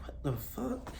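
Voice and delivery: high, breathy disbelief; stunned reaction, high and hushed; baffled what the fuck. Retold or imitated. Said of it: "It's like".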